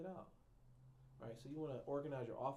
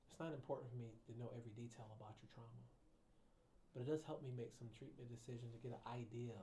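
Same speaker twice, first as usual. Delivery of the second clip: usual speaking voice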